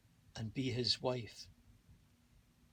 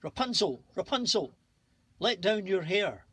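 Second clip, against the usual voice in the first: high, loud call up to the tower; 6 semitones higher, 6 dB louder than usual. A narrator's reading. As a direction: call out up to the window. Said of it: "the witch would come to the tower and call".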